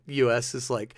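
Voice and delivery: normal talking voice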